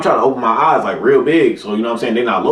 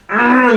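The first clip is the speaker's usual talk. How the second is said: mad mom hum; 10 semitones higher than usual. Shown as a demonstration. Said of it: "picture in your head when they mad".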